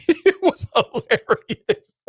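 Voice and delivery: high, slow words laughed out; laughing. Real, not acted. Vlogger cracking up over tweets roasting Cameron, laughing. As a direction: laugh through the words, tickled.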